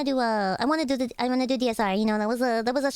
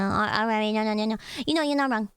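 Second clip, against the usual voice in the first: usual speaking voice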